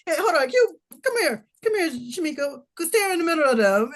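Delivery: high, loud, laughing call-over; imitating eager strangers. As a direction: mimic a pushy fan calling someone over. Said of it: "asking for pictures, like, having us".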